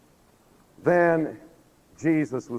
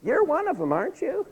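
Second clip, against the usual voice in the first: put-on very high squeaky little-girl voice; 14 semitones higher than usual. Little girl accusing Peter, in a squeaky high voice. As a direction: put on a tiny high child's voice. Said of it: "this little girl comes up".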